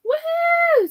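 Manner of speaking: high, excited whoop of delight; quick victory whoop; cheer. Real, not acted. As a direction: celebrate the right answer. Cheering a right answer.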